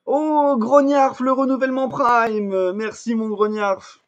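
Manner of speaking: high, drawn-out grateful exclamation; big excited thank-you, words stretched out; delighted thanks. Real, not acted. Streamer thanks viewer for Prime renewal, delighted.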